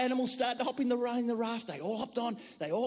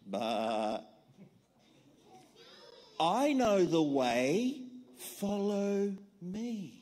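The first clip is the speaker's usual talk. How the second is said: low, slow, gentle little lamb; small lamb speaking slow and low; 4 semitones lower, much slower than usual. Acted. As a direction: calmly offer the way. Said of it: "heard a little voice".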